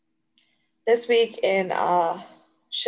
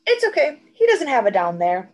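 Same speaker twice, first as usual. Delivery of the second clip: imitated child; 5 semitones higher, 5 dB louder than usual. Tutor retelling a boy's excuse for his drawing, imitated child. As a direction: retell a kid's matter-of-fact reassurance.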